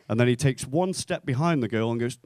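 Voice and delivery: normal talking voice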